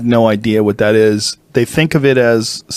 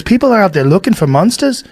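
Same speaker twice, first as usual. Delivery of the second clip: put-on higher, excited Australian accent; mock Australian, raised high; Adam Green impression; 8 semitones higher than usual. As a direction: pitch it up in a hammed Aussie voice. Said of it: "worst Adam Green impression".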